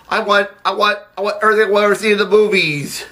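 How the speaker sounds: high, rushing demand; impassioned outburst. Acted. Downtrodden accountant demanding his share, impassioned high outburst.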